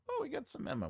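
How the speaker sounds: ordinary talk, nothing marked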